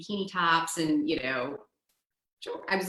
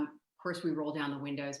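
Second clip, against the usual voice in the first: usual speaking voice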